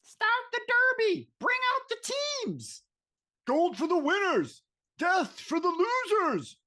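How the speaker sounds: high-pitched cheering spectators; put-on high voice, strained cries without real volume; wild crowd. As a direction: play a string of excited fans calling out. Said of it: "the crowd goes wild, Bob".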